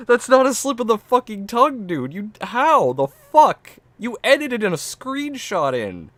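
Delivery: loud, very high yell; exasperated high shouting; incredulous outburst. Real, not acted. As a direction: explode in disbelief, stretching the words. Outburst at the absurd excuse.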